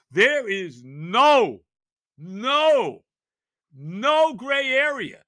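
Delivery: slow, loud, every no hammered; emphatic no. Real, not acted. Host hammering Jets fans on the Patriots game, slow emphatic no. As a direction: drag out each no like a verdict.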